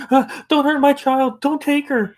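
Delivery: high, loud, slow pleading; terrified mother. Acted. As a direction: beg for the child in terror.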